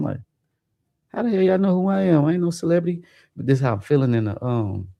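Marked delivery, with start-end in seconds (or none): 1.1-3.0 high, incredulous retold outburst; mock-baffled protest, pitched up high. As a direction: act stunned that strangers know you. Bystanders keep calling him celebrity.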